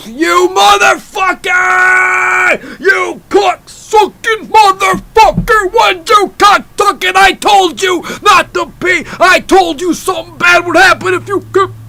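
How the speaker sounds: high, roaring, drawn-out rant; hammed-up furious tirade at full volume; enraged wrestling promo. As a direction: scream it like a wrestler cutting a promo. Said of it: "I was mad. I was".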